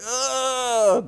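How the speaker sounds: high, drawn-out "ugh"; imitating a revolted groan; mock disgusted groan. Acting his face-washing with the underwear, mock disgusted groan. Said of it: "that's when he's like".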